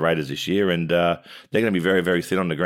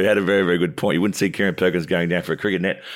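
normal talking voice

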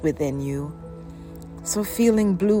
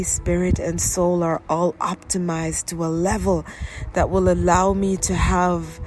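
ordinary talk, nothing marked

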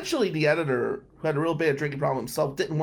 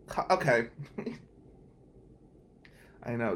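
ordinary delivery

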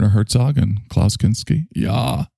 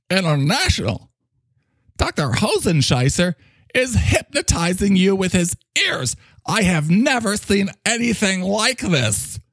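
theatrical amazed character voice; astonished sidekick; 11 semitones higher than usual. Acted. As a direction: marvel as if witnessing a miracle. Plays astonished Mike.

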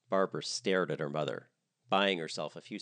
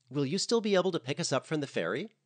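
much higher, louder chirpy voice; cheery guest; 8 semitones higher, 5 dB louder than usual. Acted. A guest texts about arriving on the ferry, high and chirpy. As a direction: read it like a bubbly tourist.

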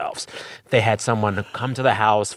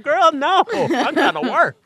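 much higher, louder squeaky dog voice; voicing the dog; 4 dB louder than usual. Dog refusing to stop barking, very high whiny voice. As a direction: make the dog whine it, put-upon and shrill. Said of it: "And it's like".

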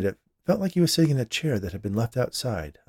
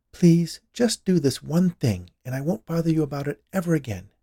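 higher wifely plea; pleading; 7 semitones higher, 4 dB louder than usual. A narrator's reading. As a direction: beg your husband to cooperate. Wife pleads with her husband.

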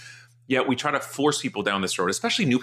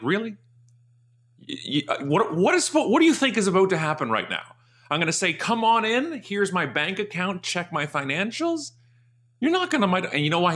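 sarcastic retort; 10 semitones higher than usual. Retold or imitated.

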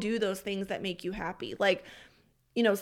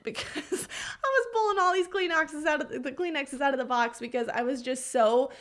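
high, laughing retelling; amused at your own meltdown, voice pitched up; 5 semitones higher than usual. Real, not acted. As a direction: laugh while admitting the tearful episode. Laughs about her own crying.

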